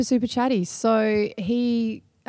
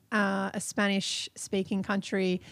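ordinary talk

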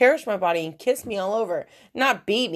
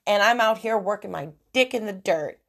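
normal talking voice

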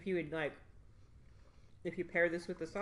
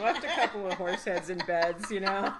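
laughing; 3 semitones higher, 8 dB louder than usual. Real, not acted. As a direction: joke while cracking up. Laughing joke reply.

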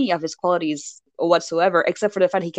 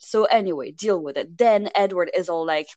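ordinary talk, nothing marked

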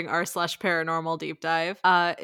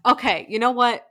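ordinary talk, nothing marked

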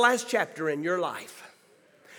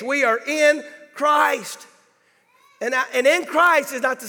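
preaching; 4 semitones higher than usual. Acted. Pastor preaching the key point.

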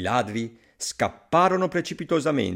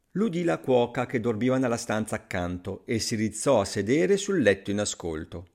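plain reading voice

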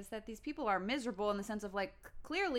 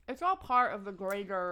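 wet clicky mouth noises; wet mouth clicks; 6 dB louder than usual. Real, not acted. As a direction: lip smacks between the words. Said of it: "God, these mouth sounds".

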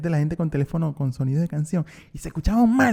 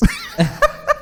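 high laugh. Real, not acted. Laughs at own attempt.

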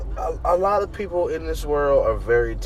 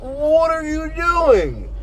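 exasperated disbelief; 10 semitones higher, slower than usual. Real, not acted. Exasperated at Fat Thor.

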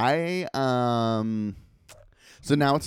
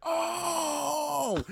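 startled cry. Retold or imitated. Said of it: "And she's like".

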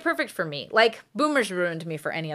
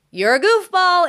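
higher, louder playful tease; teasing the dog; 9 semitones higher, 8 dB louder than usual. Retold or imitated. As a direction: call him out affectionately, higher and louder. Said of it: "And I'm like".

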